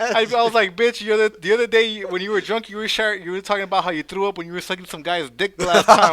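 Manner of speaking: loud, high indignant retort; retold angry call-out; mad. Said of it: "Why you sound so mad?".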